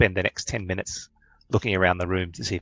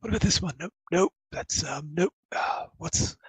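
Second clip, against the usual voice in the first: high, soft muttering to himself; mumbling professor; 8 semitones higher, 6 dB quieter than usual. Acted. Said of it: "you hear him mumbling".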